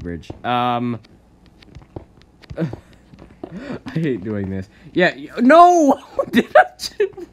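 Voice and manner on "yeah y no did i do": laughing shriek. Real, not acted. Laughing disbelief at own fail.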